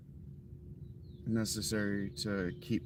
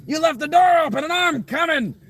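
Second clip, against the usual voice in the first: much higher, much louder screaming tick monster; menacing tick voice shouting; voicing a tick; 13 dB louder than usual. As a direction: shriek like a tick coming for you. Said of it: "He says".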